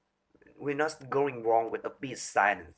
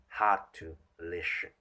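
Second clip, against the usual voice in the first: monotone demo; 6 semitones lower, 3 dB quieter than usual. Shown as a demonstration. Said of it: "on one of note are already quiet".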